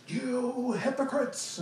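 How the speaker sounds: high, slow, exaggerated yell of a wild-eyed movie prophet; a mock furious rebuke stretched out slowly; mock screaming prophet. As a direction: ham it up like an old Jesus movie. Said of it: "just screaming and yelling at people".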